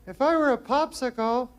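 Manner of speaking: put-on high, coy dating-show girl; girly contestant voice. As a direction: put on a high giggly girl's voice. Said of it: "Girl gotta go".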